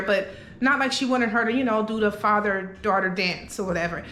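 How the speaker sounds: ordinary delivery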